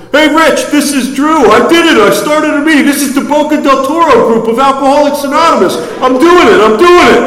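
very high, loud goofy shouting. Retold or imitated. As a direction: crow it out like a goofy friend. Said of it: "I got this goofy voice".

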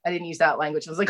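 ordinary delivery

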